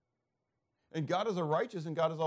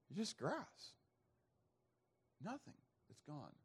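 hushed; 14 dB quieter than usual. Acted. Preacher dwelling on human frailty.

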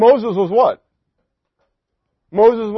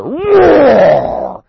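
mock roar; 8 semitones higher, 8 dB louder than usual.

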